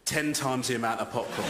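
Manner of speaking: ordinary talk, nothing marked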